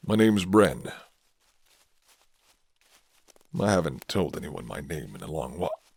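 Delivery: a hint of a guarded werewolf offering shelter; gruff werewolf man. Werewolf reveals his name.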